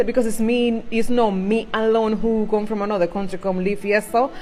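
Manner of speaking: ordinary talk, nothing marked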